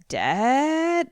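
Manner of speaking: "Dead" stretched out, sliding high up; questioning. Retold or imitated. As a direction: draw out "Dead" into a big rising question. Acts own puzzled reaction.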